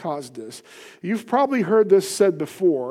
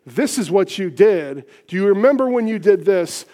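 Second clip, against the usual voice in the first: louder, pointed accusing demand; accusing confrontation; 5 dB louder than usual. Shown as a demonstration. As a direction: confront them, finger pointed. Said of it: "come in and say".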